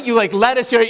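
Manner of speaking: high, coaxing parent voice; a high parent voice listing foods. Retold or imitated. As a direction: keep pleading with the child. Parent coaxing picky child.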